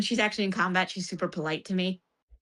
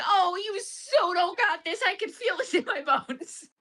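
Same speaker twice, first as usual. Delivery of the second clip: mock doom; 9 semitones higher than usual. Mock reply to doll.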